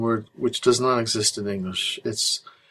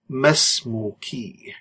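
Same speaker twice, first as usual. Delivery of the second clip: Masmuki drawn out very slowly; teacher stretching out Masmuki; slow model word; much slower than usual. Shown as a demonstration. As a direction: stretch Masmuki for learners to copy. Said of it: "teacher models the word slowly".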